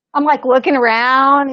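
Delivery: usual speaking voice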